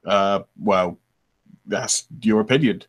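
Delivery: low-pitched retort in the trial voice. Shown as a demonstration. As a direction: keep the voice dropped low. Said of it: "a lower voice".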